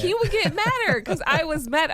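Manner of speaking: laughing while telling on her husband. Real, not acted. Wife laughing at her husband's temper. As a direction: giggle through the words.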